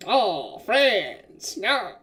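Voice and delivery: exaggerated game-character voice with swooping pitch; Yakuza character. Shown as a demonstration. Game master demoing a rejected voice, exaggerated character voice. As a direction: ham up each word, sliding downward. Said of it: "I could do the voice".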